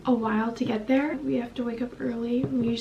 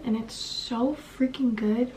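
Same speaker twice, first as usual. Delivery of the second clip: ordinary talk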